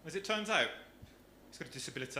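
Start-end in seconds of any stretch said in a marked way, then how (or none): 1.5-2.2 low, soft mock Dudley accent; Dudley twang on the punchline. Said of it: "words I've been coaching him that you can't say".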